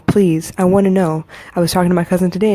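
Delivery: ordinary delivery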